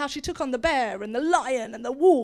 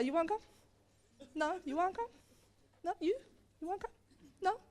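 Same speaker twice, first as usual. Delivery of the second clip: hushed, questioning little prods; soft taunting; 3 semitones higher, 10 dB quieter, faster than usual. Acted. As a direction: quietly dare each one in turn. Giant taunts silent, reluctant army.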